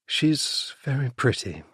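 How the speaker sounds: understated wistful remark; sighing. A narrator's reading. Bee to Freddy, watching a rival, mildly wistful sigh. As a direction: say it on a light sigh. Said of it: "she said with a sigh".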